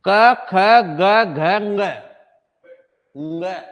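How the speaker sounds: letters spelled out slowly; slow recitation. Shown as a demonstration. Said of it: "teacher recites letters". (धीरे-धीरे वर्णमाला पढ़ना)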